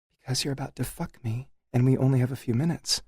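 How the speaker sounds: lightly voiced impatient woman; urgent wife. A narrator's reading.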